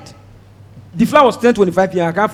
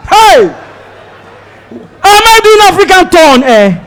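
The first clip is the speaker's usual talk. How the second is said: exasperated outburst; 8 semitones higher, 4 dB louder, slower than usual. Retold or imitated. Outraged at another stop.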